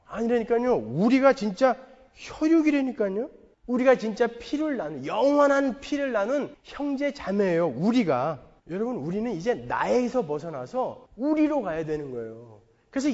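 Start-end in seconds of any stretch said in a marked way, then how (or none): none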